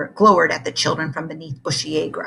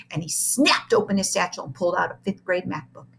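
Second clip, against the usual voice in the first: usual speaking voice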